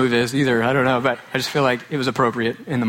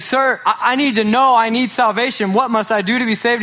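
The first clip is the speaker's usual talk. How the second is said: pleading; 8 semitones higher than usual. Retold or imitated. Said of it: "he's like".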